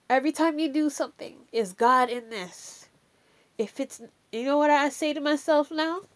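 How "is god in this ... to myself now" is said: ordinary delivery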